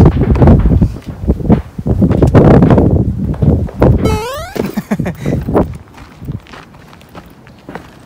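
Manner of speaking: long high unvoiced laugh. Real, not acted. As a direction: burst out laughing for a long time.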